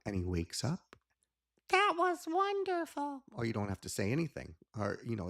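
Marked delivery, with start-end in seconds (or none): 1.7-3.2 very high, loud cheerful praise; delighted praise. Acted. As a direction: praise him like a delighted teacher. Praising child after the demo.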